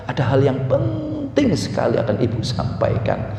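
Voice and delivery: low, soft, solemn announcement; overly serious. Acted. Mother summoning her children to an important meeting, solemn. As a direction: address the children gravely in a low, measured voice. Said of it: "serius, jangan".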